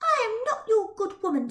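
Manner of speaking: put-on high, sharp indignant girl voice. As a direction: bristle with offended pride. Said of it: "eyes ablaze with indignation".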